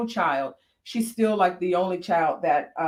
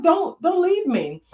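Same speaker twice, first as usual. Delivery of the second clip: higher mock plea, hammed-up; mock pleading; 9 semitones higher, 3 dB louder than usual. Mother playfully begging her daughter not to leave. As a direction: beg like a scared mom, playfully. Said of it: "And I was like".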